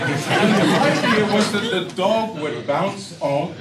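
slow and loud, laughing as he explains; chuckling through the words. Real, not acted. Comic explaining the bouncing dog to the crowd, laughing.

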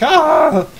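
short, high, loud scream; acted scream. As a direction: cry out sharply as the creature screams. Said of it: "screams, like".

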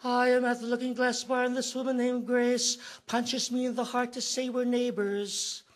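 usual speaking voice

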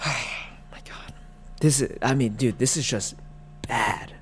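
soft whispered sigh of disbelief; whispered exasperation; exasperated whisper. Real, not acted. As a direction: breathe it out in despair. Exasperated reaction.

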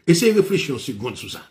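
normal talking voice

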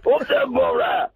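high, breathy bellow of a rutting stag; mating buck deer call. Acted. Caller doing a mating deer's call to the Borough fans, high and airy. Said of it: "How would that sound?".

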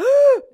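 loud, high-pitched excited gasp; sharp fangirl gasp, acted out; starstruck gasp. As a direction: gasp out loud on seeing an idol. Retelling a starstruck meeting.

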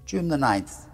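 ordinary delivery, nothing marked

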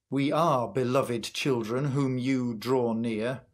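ordinary delivery

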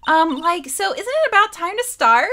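put-on high, loud valley girl voice; man playing a bubbly valley girl. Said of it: "Like, Valley Girl's good for this".

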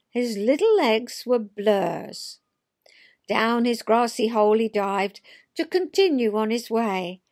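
lilting read-aloud of a rhyming poem; storyteller reading a funny poem. A narrator's reading. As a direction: tell it like a children's verse tale.

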